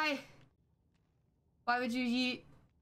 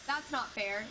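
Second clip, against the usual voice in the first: ordinary delivery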